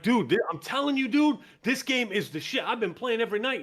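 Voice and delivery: mock hyped gamer pushing a game; hyped-up pitch. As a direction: sell it like the best game ever. Hyping a game to friend.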